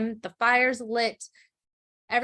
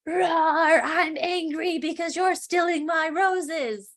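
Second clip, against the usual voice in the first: roaring beast; 7 semitones higher, slower than usual. Retold or imitated. Beast catches the father stealing roses, mock roaring. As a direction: roar it out like a cartoon beast, slowly. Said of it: "a beast jumps out and is like".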